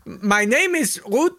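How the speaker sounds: injured pilot at a farm door, mock German accent. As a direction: ham up a German accent, laughing through it. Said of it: "knocks on the door and says".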